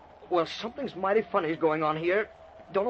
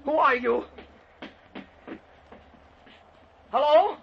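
high, raised, alarmed challenge; calling out; 8 semitones higher, 4 dB louder than usual. Acted. Man challenging an unseen intruder, alarmed call. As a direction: call up loudly into the empty house.

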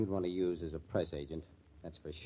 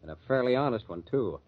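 louder, higher, bristling comeback; angry retort; 4 semitones higher, 7 dB louder than usual. Acted. Mike snaps back at the actress who scorned him, angry and loud. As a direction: throw her question back in her face. Mike turns on Monica angrily.

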